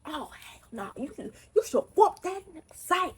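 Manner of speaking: high hyped-up egging on; mock outraged friend. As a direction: urge her to fight.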